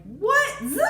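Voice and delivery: high, loud startled what; mock shocked rising what. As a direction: jolt out of the moment. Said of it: "she was like".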